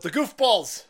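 loud, punchy call-out; playful loud outburst; mock exclamation. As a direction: announce it with a loud comic kick. First take, called out.

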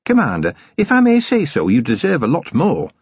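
high, respectful voice to the commander; dutiful dwarf sergeant, supportive. Acted. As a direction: start high and console him respectfully.

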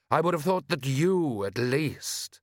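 high, drawn-out lordly voice; a disdainful aristocrat's rising disbelief; haughty Lord Rust. Acted.

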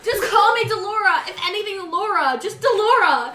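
insistent call. Real, not acted. Player telling the table what to call her, insistently loud. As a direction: insist on the name, playfully loud.

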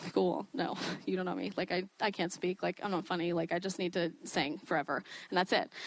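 quiet, low mumbled-down protest; self-doubting brush-off. Retold or imitated. Speaker answering those who say she should preach, self-doubting brush-off. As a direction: softly talk yourself out of it.

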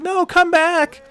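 high, loud, slow plea; desperate yell. Retold or imitated. Lenny chasing the kids, high slow loud plea. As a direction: stretch it out, begging them back. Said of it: "He's like".

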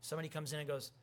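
ordinary talk

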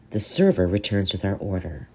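usual speaking voice

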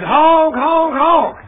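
high, loud honking breaking into laughter; silly clown. Retold or imitated. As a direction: honk like a horn, laughing. Honking at the sign.

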